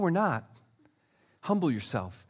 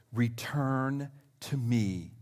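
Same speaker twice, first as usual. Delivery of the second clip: very slow, soft invitation; tender; 5 semitones lower, 6 dB quieter, slower than usual. Acted. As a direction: draw it out gently, softer. Slow tender scripture reading.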